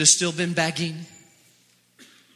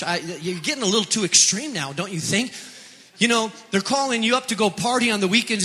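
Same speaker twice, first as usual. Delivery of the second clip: usual speaking voice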